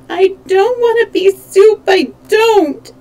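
put-on high, loud childish wail; a small boy protesting through tears; crying protest. A narrator's reading. Scared boy protesting to the Scoodlers, high tearful wail. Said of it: "he began to cry again".

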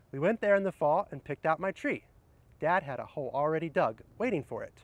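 cheerful read-aloud storytelling; reading to children. Acted. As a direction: read it out like story time for kids. Reads picture book aloud.

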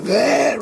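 high zombie moan; zombie noise. Pretend. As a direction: let out a goofy zombie groan. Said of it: "That's my zombie noise".

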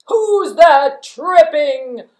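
very loud, slow bellowing troll. Acted. Troll catching goat on bridge, loud slow bellowing troll. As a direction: roar the challenge like a bridge troll.

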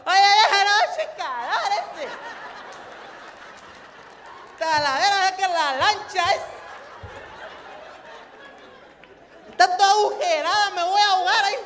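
put-on high, loud, slurred coastal Mexican accent; exaggerated costeño accent, shouting with loose consonants; overheated coastal accent. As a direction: wail it in a hammed-up costeño accent. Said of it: "demasiado calor como para hablar bien".

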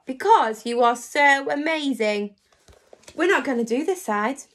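ordinary delivery

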